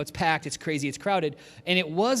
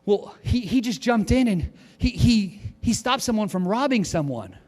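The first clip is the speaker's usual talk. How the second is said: excited retelling; 5 semitones higher, 4 dB louder than usual. Retold or imitated. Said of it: "she's like".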